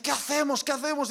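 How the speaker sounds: high, anxious questions; the wife asking what to do, alarmed; worried wife. Retold or imitated. Wife panicking. (la esposa preocupada, aguda)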